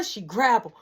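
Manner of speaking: usual speaking voice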